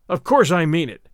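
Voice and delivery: loud, forceful reply; thundered. A narrator's reading. Narrator thunders back at Larry, loud. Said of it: "I thundered at him".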